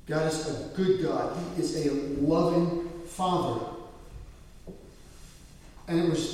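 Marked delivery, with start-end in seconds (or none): none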